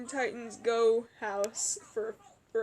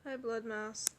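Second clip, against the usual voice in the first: usual speaking voice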